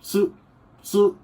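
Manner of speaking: सू said with a light t before it, as tsu; tsu sound. Shown as a demonstration. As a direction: Japanese tsu, not su. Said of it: "हल्का सा टी की आवाज़ निकालनी है".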